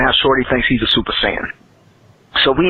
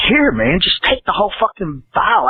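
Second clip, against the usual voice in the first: higher-pitched exasperated giving in; imitated exasperation, pitched up; fed-up dealer; 7 semitones higher than usual. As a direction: act out a dealer losing patience. Fed-up dealer acted in story.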